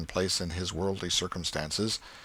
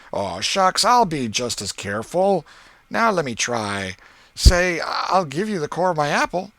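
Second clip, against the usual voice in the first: put-on lightly raised, eager boy voice; boy Ben coaxing; 8 semitones higher, 7 dB louder than usual. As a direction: coax him like a curious kid.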